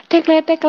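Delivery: high clicking tek sounds; imitated bike clicking. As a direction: mimic the ticking pedals. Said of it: "tek, gitu kan". (tiruan bunyi tek tek bernada tinggi)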